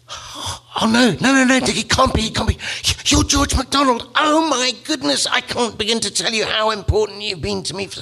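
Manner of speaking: very high, breathless fan excitement; starstruck gush. Acted. Lewis meeting George MacDonald in heaven, very high starstruck gushing. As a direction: squeal like meeting a hero.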